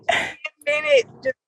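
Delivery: usual speaking voice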